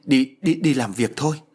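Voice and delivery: rattled, urgent order; flustered. Acted. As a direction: hurry them along in a fluster. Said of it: "bà cuống lên".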